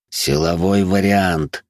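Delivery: slow hiss through gritted teeth in pain. Acted. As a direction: grit your teeth and hiss it slowly. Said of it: "сквозь зубы прошипел я".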